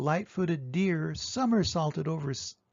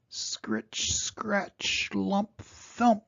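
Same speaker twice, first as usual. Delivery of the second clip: slow plodding sounds; 4 semitones higher, much slower than usual. A narrator's reading. Tortoise walking sounds.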